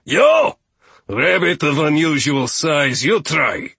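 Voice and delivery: loud, high opening cry, then imperious order; commanding steward. Acted. Steward orders the rabbit to try, loud and imperious. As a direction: call out the next victim.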